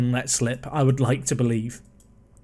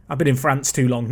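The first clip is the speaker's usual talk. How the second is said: normal talking voice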